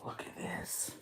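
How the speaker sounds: breathless whisper of wonder; awed whisper. Real, not acted. Opening his favorite ice cream, awed whisper. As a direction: whisper it, amazed at the treat. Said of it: "Oh my God".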